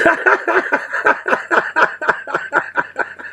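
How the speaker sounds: long, high breathy laugh; cracking up. Real, not acted. Streamer cracking up at own crude joke, long laughter. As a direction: keep laughing helplessly. Cracking up after teasing chat.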